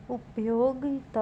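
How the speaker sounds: उपयोगिता said as up-yo-gi-ta; correct pronunciation. Shown as a demonstration. Teacher giving the correction, उपयोगिता with "gi". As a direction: sound the "gi" in उपयोगिता. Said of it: "क्या होगा?".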